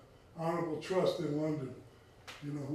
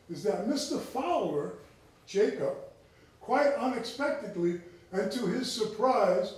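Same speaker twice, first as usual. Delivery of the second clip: put-on reading an old diary aloud; 3 semitones higher, 5 dB louder than usual. Lecturer reads the diary entry to the audience in a formal reading voice. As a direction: read the 18th-century entry like a proclamation.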